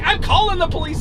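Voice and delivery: high, loud mock-angry warning; yelled threat. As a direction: threaten it at the top of an argument. Retold threat in shouting match.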